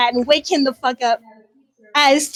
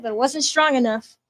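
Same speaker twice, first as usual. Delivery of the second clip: ordinary delivery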